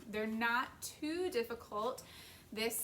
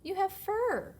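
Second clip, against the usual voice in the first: a raised, firm parent panda; higher, louder parent panda; 10 semitones higher, 8 dB louder than usual. Acted. Parent panda reminds the cub, louder and higher. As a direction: exclaim it like a patient parent.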